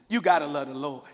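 usual speaking voice

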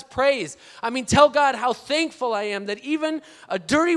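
emphatic, forceful testimony; impassioned preaching. Real, not acted. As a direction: preach it with full conviction to the congregation.

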